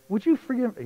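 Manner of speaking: hammed-up mom laying into them; scolding mom. Mother scolds son and dad for leaving the little one, high mock scolding.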